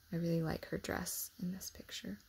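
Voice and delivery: soft aside in a whisper; hushed private comment. Real, not acted. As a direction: lean in and whisper a remark. Aside about the picture.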